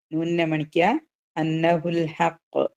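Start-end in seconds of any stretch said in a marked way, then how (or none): 1.4-2.7 slow recited phrase, falling; tajweed recitation; Quran recitation. Shown as a demonstration. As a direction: chant it slowly to a close. Recites, then repeats.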